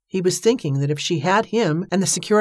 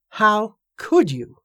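slow, drawn-out angry cry, only mildly raised; narrated shout; 4 semitones higher, 3 dB louder, much slower than usual. A narrator's reading. Joshua confronting Evangeline at her bedroom door, mild shout. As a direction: cry out in wounded accusation, slowly. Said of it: "he shouted".

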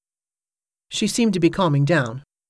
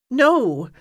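1 sharp cry; 9 semitones higher, 4 dB louder than usual. Acted. She refuses in alarm.

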